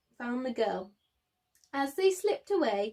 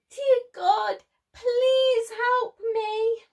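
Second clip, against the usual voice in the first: high, loud, slow pleading; frightened prayer; 11 semitones higher, 7 dB louder, slower than usual. Acted. The girl prays as baboons surround her, pleading. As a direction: beg God for help in a little girl's voice. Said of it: "the girl prayed".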